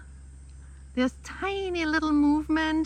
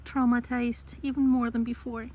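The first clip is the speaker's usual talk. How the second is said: usual speaking voice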